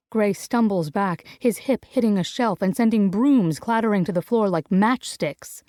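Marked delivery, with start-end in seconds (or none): none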